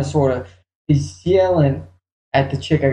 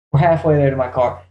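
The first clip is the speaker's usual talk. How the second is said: normal talking voice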